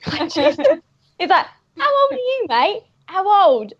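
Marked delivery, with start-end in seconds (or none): 1.8-3.7 high-pitched, insistent mock thug; comic burglar demanding. Burglar questioning the man he stabbed, mock insistent thug. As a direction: press the victim for an answer, acted. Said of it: "this burglar guy, he's like".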